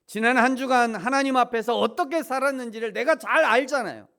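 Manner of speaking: loud, high fervent preaching; preaching at full force. Acted. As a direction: drive the point home from the pulpit. (높고 힘찬 설교조)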